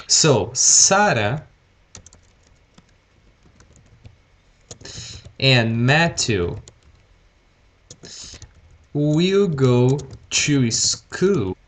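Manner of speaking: very slow, spaced words, will held; slow teaching pace. Shown as a demonstration. Teacher to online students, speaking very slowly. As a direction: spell it out slowly for learners. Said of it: "I'm trying to speak slowly".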